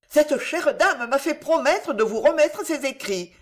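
put-on high, gushing old woman; voluble. A narrator's reading. Old woman explains her promise to Pardaillan, volubly. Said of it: "continuait dame Maguelonne avec volubilité".

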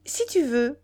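bubbling over with excitement. A narrator's reading. A cheerful mistress coaxing her lover out, animated. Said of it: "très animée".

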